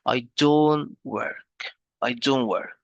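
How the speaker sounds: "don't" stretched out slowly and leaned on; stress on "don't". Shown as a demonstration. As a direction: lean hard on "don't", drawing it out. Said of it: "we put stress on the, uh, negative word".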